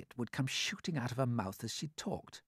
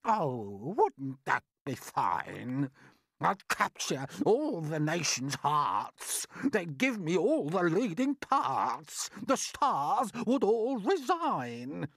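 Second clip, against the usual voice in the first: slow theatrical verse, climbing higher; vain, boastful aunt; 7 semitones higher, 5 dB louder, slower than usual. Acted. Aunt dreaming of all the leading parts, grand and boastful. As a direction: swoon grandly over your own future fame.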